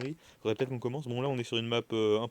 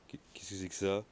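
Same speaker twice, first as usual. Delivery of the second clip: staged horror scene; 3 semitones lower, 6 dB quieter than usual. Acted. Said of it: "C'était la pire mise en scène du monde".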